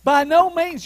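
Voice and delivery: "By no means" repeated high and firm, without drawing it out; emphatic refusal. Acted. Preacher repeating Paul's answer, emphatic. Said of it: "It's the most emphatic way of saying no".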